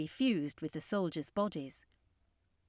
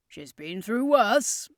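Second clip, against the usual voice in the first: louder, slow, proud; proudly; 2 semitones higher, 7 dB louder, slower than usual. A narrator's reading. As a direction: boast about the old tent. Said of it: "said proudly".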